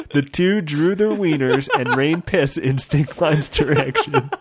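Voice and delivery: usual speaking voice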